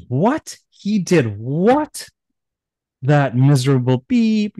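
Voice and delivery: high, incredulous roar, stretching "what"; angry outburst. Acted. Shiva hearing the throne was stolen, furious disbelief. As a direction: Shiva exploding at the news, high and slow. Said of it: "he was angry".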